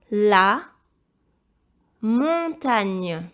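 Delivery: slow, loud, carefully enunciated dictation. Shown as a demonstration. Teacher dictating first word to pupil, loud dictation.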